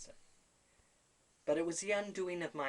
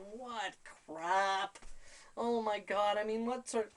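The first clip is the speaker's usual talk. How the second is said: startled disbelief; 6 semitones higher, 6 dB louder, slower than usual. Retold or imitated. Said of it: "I'm going".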